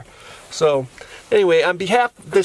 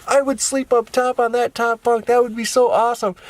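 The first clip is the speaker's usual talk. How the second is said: put-on higher-pitched eager kid; excited niece; 8 semitones higher than usual. Niece picturing sleeping on the top bunk, excited. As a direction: lightly imitate a child daydreaming aloud.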